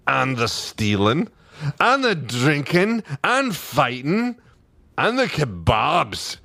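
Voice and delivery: high, drawn-out Scottish lilt; rising Scots chant, item by item; Scottish accent. A narrator's reading. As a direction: chime in like gleeful Scottish clansmen. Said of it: "you know how the accent should sound".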